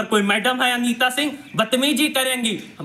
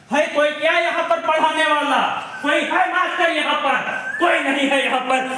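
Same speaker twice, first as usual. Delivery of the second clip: raised, higher call into the empty school; 6 semitones higher than usual. Real, not acted. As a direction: call out to find any teacher.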